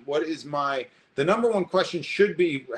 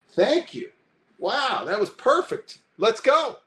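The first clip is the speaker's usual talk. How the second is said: high, louder, hammed-up delight; mock gushing relief; 8 semitones higher, 5 dB louder, slower than usual. Mocking a subject suddenly told to relax, hammed-up gushing. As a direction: gush with fake relief, over the top.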